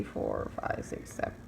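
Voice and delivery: counting under her breath; soft, voiceless murmured count; whispered counting. Real, not acted.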